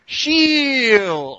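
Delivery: high, drawn-out mock holler; long, high-pitched battle cry imitation; mock battle yell. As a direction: imitate a teammate yelling at the top of his lungs. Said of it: "yelling at the top of his lungs".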